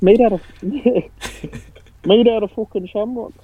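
put-on high, slow, laughing Irish accent. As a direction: laugh through a bad Irish brogue. Repeats in the Irish bit.

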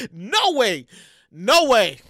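normal talking voice

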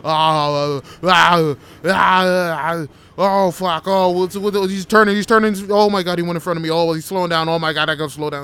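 loud, high, hysterical driver's freak-out; hammed-up terror, yelling nonstop; panicked screaming. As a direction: scream as if a truck is bearing down. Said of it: "I got super anxious".

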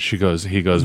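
ordinary talk, nothing marked

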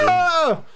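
short high yell; comic scream. Acted. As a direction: cry out as you're shot. Character gets shot.

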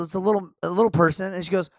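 ordinary delivery

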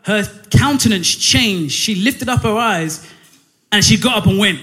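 usual speaking voice

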